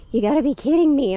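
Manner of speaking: quick, high-pitched incredulous reaction; acted disbelief. Looking at the tornado map this morning, acted disbelief.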